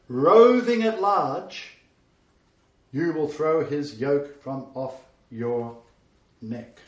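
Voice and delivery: slow, weighty prophetic pronouncement; solemn prophecy. Acted. Isaac pronouncing Esau's fate, slow and solemn. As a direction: intone it like a prophecy.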